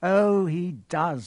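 breezy villain's drawl; casual. A narrator's reading. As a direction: agree casually, drawing it out. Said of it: "said Quirrell casually".

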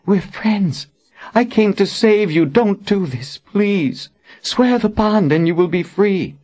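high, desperate entreaty; pleading. A narrator's reading. Verna begs the friends she came to save, pleading. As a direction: implore old friends in a high voice. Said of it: "Verna pleaded".

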